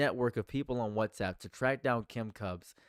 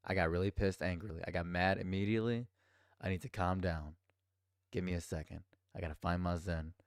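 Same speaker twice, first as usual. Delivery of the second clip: normal talking voice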